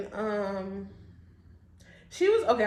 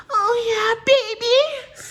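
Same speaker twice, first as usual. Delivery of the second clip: loud, very high-pitched play voice; playful high squeal; 12 semitones higher, 8 dB louder, much slower than usual. Pretend. Admiring her own dimple mid-story, playful high squeal.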